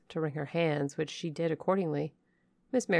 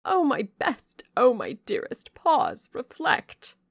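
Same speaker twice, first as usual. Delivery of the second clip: high, loud, fretful plea; anxious mother's cry; 8 semitones higher, 8 dB louder, slower than usual. Acted. Lady Ingram cries out to her daughter, high and fretful. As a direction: beg her daughter to stop. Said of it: "was her mamma's cry".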